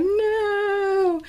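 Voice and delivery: long, high, loud wail of dismay; Buddy. Retold or imitated. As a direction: overdramatic movie-style cry of horror. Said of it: "it was just like when he finds out his dad is on the naughty list".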